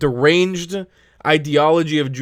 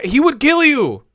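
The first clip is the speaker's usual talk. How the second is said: much higher, slow, heated; heated warning; 9 semitones higher than usual. Real, not acted. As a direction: hammer each word as a warning. Outraged rant at a panelist.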